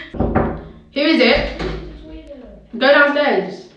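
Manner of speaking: loud, high, drawn-out call; real calling out. Real, not acted. Big sister sending little sister downstairs, calling out. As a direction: call out sharply to someone in the house.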